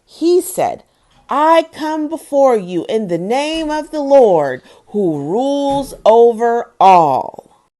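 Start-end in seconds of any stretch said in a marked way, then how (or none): 1.3-7.7 louder, rousing storytelling; dramatic story narration. A narrator's reading. Mother reading the David and Goliath climax to children, dramatic. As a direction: read the battle with mounting drama.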